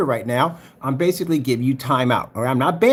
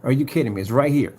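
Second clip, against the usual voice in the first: ordinary talk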